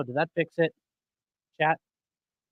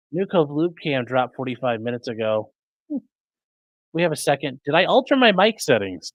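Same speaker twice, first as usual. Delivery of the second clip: usual speaking voice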